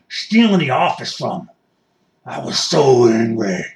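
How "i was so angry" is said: hammed-up anger. States his anger at the rally.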